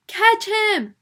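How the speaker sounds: high, loud urgent call. A narrator's reading. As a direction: yell it after the fleeing dormouse. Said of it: "called the Mad Hatter".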